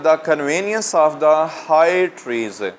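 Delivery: slow, deliberate verse reading; poem recitation. Real, not acted. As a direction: read the verse out slowly to a class. Reads poem line aloud to students.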